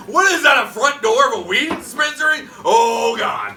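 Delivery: hammed-up terrified friend imitation; scared to death. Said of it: "he's so scared to death. He's like".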